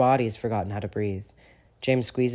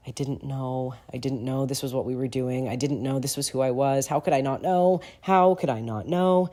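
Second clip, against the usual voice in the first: spiral from shaken to frantic; rising panic; 3 semitones higher than usual. Acted. Regulus pulling away from the kiss, rising panic.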